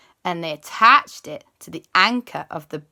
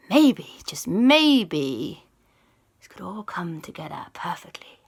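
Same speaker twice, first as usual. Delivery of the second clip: scheming whisper; 3 dB louder than usual. Acted. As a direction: breathe the plan to yourself. Lisa's scheming thought.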